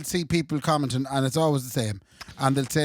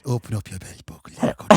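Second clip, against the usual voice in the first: low, softer Louth ASMR parody; Louth accent; 9 semitones lower, 5 dB quieter than usual. Acted. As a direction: coax in a mock Louth accent, deep and low. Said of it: "You have to do in a loud accent".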